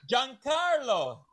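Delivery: high, drawn-out summons; stretched-out theatrical call. Acted. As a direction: call for the butler in a long high cry. Patriarch calls for the butler.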